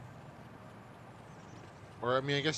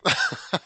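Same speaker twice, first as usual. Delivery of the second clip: short laugh; 8 dB louder than usual. Real, not acted. Laughs at the slim odds.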